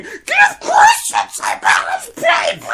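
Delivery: very high, slow tantrum shriek; screaming little girl. Retold or imitated. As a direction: keep shrieking at no one like a frantic child.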